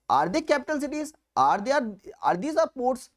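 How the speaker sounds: ordinary delivery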